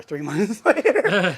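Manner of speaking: slow, loud words broken by a laugh. Real, not acted. Telling a friend the doctor forgot, laughing. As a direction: laugh through it, amused at the irony.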